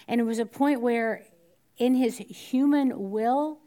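usual speaking voice

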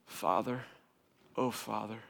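low, soft, reverent mock prayer; mock-solemn address. Kids formally addressing dad as Father, low and mock-solemn. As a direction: open a pompous prayer in a hushed low voice. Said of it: "Stop talking like that".

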